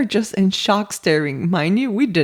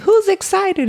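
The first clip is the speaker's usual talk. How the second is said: high-pitched, hyped-up dad voice; rally the group with mock excitement, pitched way up; excited dad; 11 semitones higher, 4 dB louder than usual. Dad rallying the kids at a theme park, excited. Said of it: "And he's going".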